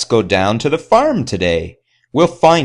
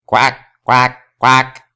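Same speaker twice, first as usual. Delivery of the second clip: a quacking duck voice; 3 semitones higher, 4 dB louder, slower than usual. Acted. Reading to children, the pond duck quacks. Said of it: "says the duck".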